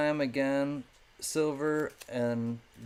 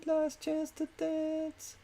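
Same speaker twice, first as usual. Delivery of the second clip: very high, slow sung falsetto call; final call; slower than usual. Acted. Host teasing bidders before closing, high slow sung call. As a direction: croon it up high as a last warning.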